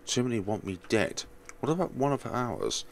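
usual speaking voice

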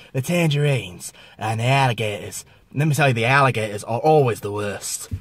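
broad mock Australian accent; hammed-up Australian accent. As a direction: play an Aussie wildlife guide, broad accent. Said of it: "here's my favourite accent, Australian".

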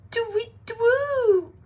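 loud, very high drawn-out hooting; owl hoot. Acted. Owl hoots in alarm.

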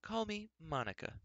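giving a false name carefully; hesitant woman. A narrator's reading. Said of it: "There was that nervous pause again".

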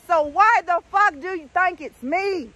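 slow, pointed mock confrontation; demanding slowly why it's her; retold angry challenge. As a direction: drag out the accusation, daring him. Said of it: "I said".

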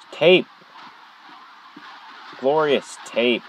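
high gasp then savoring glorious; delighted find. Real, not acted. Player spots tape, gasps with delight.

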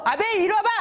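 high, acted angry call; furious summons. Enraged Cain summoning his brother Abel, high-pitched angry acting. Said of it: "너무너무 화가 나서".

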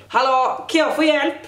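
calling out. Retold or imitated. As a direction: call the teacher by the wrong name. Calling teacher wrong name. (ropar högre i klassrummet)

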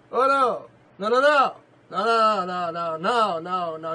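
loud, high string of no's; emphatic denial. Acted. Preacher denying Keller got it from books, loud high shouting. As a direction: shout it down at full force. Preacher rejecting his own suggestion.

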